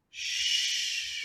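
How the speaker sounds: unvoiced whispered shush; kids' shush. Kids in a cute video hushing a chatty crowd, whispered shush. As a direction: hush the room like a kid in a video. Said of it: "a cute video that goes".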